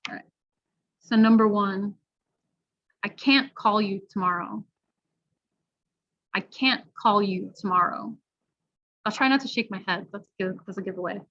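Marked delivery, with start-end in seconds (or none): 3.0-4.6 CAN'T stressed with a very clear "a" vowel. Shown as a demonstration. Teacher reading a listening test item, stressed CAN'T. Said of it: "or am I stressing it and saying can't". 6.3-8.2 CAN'T stressed with a very clear "a" vowel. Shown as a demonstration. Teacher reading a listening test item, stressed CAN'T. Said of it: "or am I stressing it and saying can't".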